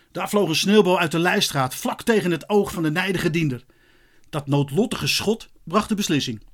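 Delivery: ordinary delivery, nothing marked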